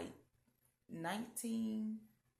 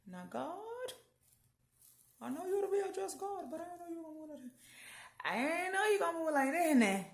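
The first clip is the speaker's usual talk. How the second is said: high, loud, incredulous cry of thanks; stunned praise, voice climbing high and loud; amazed prayer to God; 9 semitones higher, 7 dB louder than usual. Retold or imitated. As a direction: shout up to God in disbelief. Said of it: "I was like".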